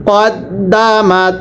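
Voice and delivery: কদ্দামাত drawn out slowly, short ক then doubled দ্দ; slow spelled-out Quran reading. Shown as a demonstration. Teacher models কদ্দামাত slowly for the class. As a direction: stretch each sound of কদ্দামাত, doubling the দ. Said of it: "আবারও একসাথে পড়তে হবে".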